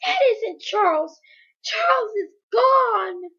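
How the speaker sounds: high, loud, desperate scream; frantic cry at the top of the voice; shrieking. A narrator's reading. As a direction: shriek it, dragging out the last words.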